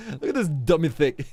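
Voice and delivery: loud and laughing; giggling through the words. Real, not acted. Laughing at the crazy mountain.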